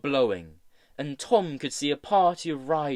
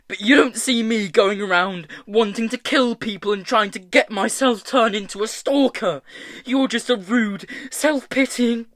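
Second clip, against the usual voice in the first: high, loud, heated tirade; raised voice, furious and hurt; angry screaming; 7 semitones higher, 7 dB louder than usual. A narrator's reading. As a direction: rant at a friend in pain and anger. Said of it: "Tom screamed".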